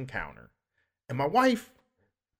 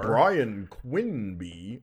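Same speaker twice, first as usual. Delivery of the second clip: very slow, lower announcer voice; intercom radio voice; 4 semitones lower, much slower than usual. Acted. Mocking a store worker paging him by name, intercom radio voice. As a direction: drag the name out like a store page. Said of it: "they use, like, a radio voice".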